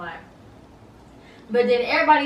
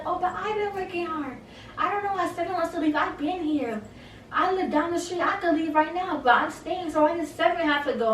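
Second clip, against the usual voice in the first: higher, put-on whiny voice for the bragging housemate; mocking Sarah impression; 8 semitones higher than usual. Vlogger mocks Sarah's bragging to the house, whiny high voice. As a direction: make fun of her, pitch way up and whine.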